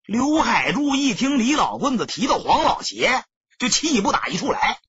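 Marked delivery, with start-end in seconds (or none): none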